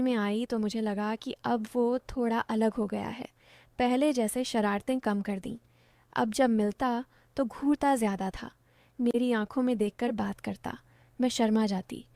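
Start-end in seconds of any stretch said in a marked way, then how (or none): none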